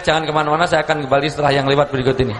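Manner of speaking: a speaker joking like a host before a commercial break; mock TV host. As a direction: rattle it off like a show host going to ads. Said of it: "Neng iklan kok".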